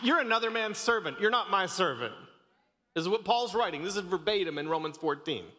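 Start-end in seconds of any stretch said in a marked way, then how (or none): 0.0-1.7 higher, impassioned preacher push; fervent preaching. Acted. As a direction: preach it hard to the congregation. Preaching at full force.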